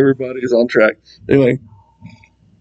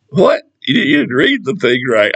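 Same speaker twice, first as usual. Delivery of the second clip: mock disbelief; 6 semitones higher than usual. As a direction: throw out a stunned What?. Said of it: "mock disbelief at Satanists".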